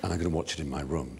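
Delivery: confiding. Retold or imitated. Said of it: "and she says".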